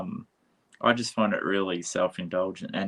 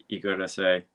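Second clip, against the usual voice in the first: ordinary talk, nothing marked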